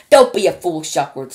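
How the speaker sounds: loud, irritated retort; snapped. Acted. Ground control snapping at Shuckworth, loud and irritated. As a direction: snap it back, short-tempered. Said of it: "snapped ground control".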